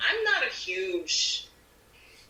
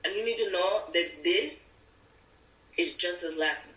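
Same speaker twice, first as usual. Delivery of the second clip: Latin accent. Shown as a demonstration. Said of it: "do it in the Spanish accent".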